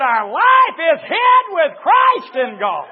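loud, high, slow proclamation; shouted preaching. Real, not acted. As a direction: ring the scripture out over the congregation. Preacher proclaims scripture at full force.

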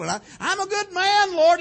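mock a self-righteous man proclaiming his goodness; boastful Pharisee. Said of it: "That's what he said".

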